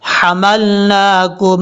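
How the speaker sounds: drawn-out chanted tajweed reading; slow Quran recitation. Shown as a demonstration. Teacher reciting verse slowly for students. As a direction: recite slowly, stretching each sound.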